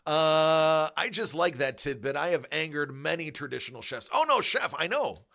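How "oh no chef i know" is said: high, loud mock whine; a hammed-up snooty chef protesting; mock offended chef. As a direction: play the outraged traditional chef. Mocking chefs he angered.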